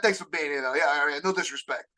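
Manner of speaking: ordinary delivery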